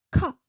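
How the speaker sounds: "ka" short with a puff after the k; burst of air. Shown as a demonstration. Teacher comparing the pitch level, airy burst on "ka". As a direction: punch out an aspirated "ka". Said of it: "a burst of air".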